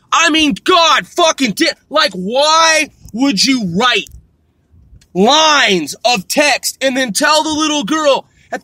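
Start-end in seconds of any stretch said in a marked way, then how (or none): none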